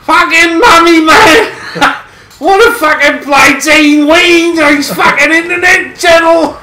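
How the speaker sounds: mock Aussie mum yelling. Mocking the Aussie opponent's mum telling him off, hammed-up shout. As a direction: bellow like a cross mum over the internet, hammed up.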